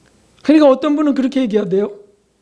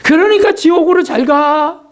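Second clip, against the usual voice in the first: mock curse; 7 semitones higher than usual. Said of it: "이렇게 끔찍한 저주도 하고요".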